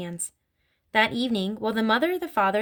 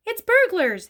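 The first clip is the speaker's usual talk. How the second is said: high, loud, voiced hiss of alarm; urgent hiss; 11 semitones higher, 7 dB louder, slower than usual. A narrator's reading. Mother warns the family of burglars, hissing urgently. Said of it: "hissed the mother".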